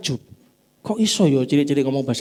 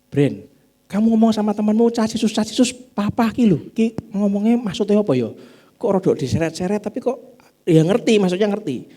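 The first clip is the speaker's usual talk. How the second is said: wife's voice; 6 semitones higher than usual. Retold or imitated. Preacher voices wife asking.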